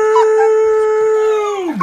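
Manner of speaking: loud startled Damn; shocked shout. Real, not acted. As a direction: blurt it out, stunned. Shocked at missing court.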